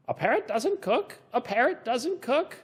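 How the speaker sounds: mock parrot echo. Echoed as question.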